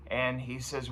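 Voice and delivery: usual speaking voice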